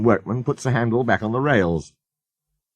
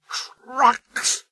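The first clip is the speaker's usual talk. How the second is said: very high, scornful snort on Trucks!; a proud engine scoffing at trucks; 13 semitones higher than usual. A narrator's reading. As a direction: spit out Trucks! in disgust. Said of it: "snorted Sir Handel".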